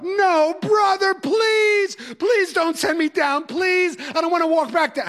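put-on high, pleading wail of a boy begging his teacher; wailing plea. Student begging teacher not to send him downstairs, high wailing plea. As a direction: whine it out like a kid begging not to be sent away. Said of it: "And the wailing would start".

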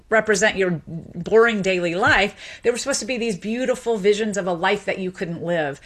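ordinary talk

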